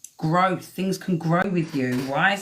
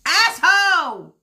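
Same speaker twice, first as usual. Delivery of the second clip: sudden high shout, dragged out; screaming at a card; 12 semitones higher, 7 dB louder, slower than usual. Real, not acted. Reader shouting at a tarot card. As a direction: yell it at the card, half joking. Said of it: "I'm screaming at the King Cup".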